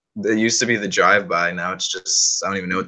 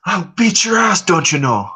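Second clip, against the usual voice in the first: much higher, louder mock Minnesota tough guy; Brock Lesnar with Minnesota accent; 10 semitones higher, 4 dB louder than usual. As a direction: threaten like a folksy Midwestern brute. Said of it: "he's like".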